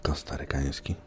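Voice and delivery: hushed sleepy whisper. Real, not acted. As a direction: breathe it out, just awake. Sleepy whisper after waking.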